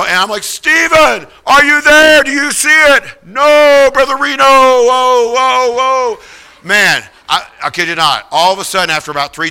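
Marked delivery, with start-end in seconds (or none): 0.4-3.2 high, loud holler up the mountain; shouted call. Retold or imitated. Calling up the mountain. 3.2-6.2 winded shout. Retold or imitated. Climber shouting back, winded.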